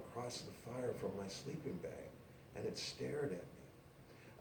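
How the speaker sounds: slow, soft, almost breathless; hushed. Acted. Woman recalling mountain lion, hushed fear. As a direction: hush it like you're still frozen. Scared story hush.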